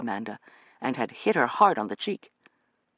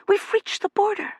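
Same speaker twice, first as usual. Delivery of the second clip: excited; 3 dB louder than usual. Acted. Reaching the border at last.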